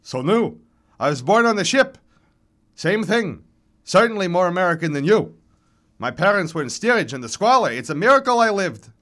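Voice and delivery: high, loud, quick self-justification; worked-up old man rattling off his defence; defensive old man. A narrator's reading. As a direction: argue back with indignant pride. Old men squabbling on stage.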